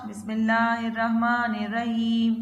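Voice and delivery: slow, drawn-out tajweed chant, phrase by phrase; Quran recitation. Acted. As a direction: chant each phrase slowly for the child to repeat. Third recitation of the surah.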